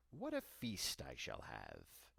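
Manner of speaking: low, slow gloat over the feast; low and slow, a witch gloating to herself. A narrator's reading. Witch gloating over sleeping children.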